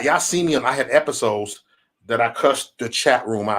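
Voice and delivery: usual speaking voice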